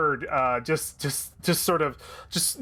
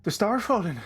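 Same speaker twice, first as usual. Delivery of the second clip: raised, lilting Irish character voice; Biff; 4 semitones higher than usual. Acted. Biff explaining Starfallen to Victor, Irish accent. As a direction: tell her story in a high Irish accent. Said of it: "no, that's the voice".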